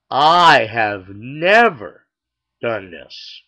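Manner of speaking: slow, with NEVER hit loud; emphatic boast. Acted. Cook boasts to his wife, slow heavy stress on never. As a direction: draw out "I" and punch "never", then drop.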